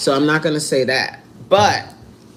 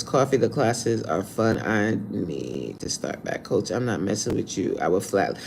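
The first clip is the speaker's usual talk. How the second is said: much lower, softer mock voice; mocking whine; 7 semitones lower, 8 dB quieter than usual. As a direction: read it like a lazy excuse. Reads chat in mocking voice.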